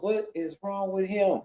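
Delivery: hushed cousin. Retold or imitated. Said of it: "my cousin goes".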